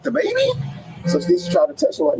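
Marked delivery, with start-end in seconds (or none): 0.0-0.5 high disbelieving squeak; dad asks the lady, stunned and very high; incredulous squeak. Retold or imitated. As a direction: shoot way up in disbelief. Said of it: "I'm like".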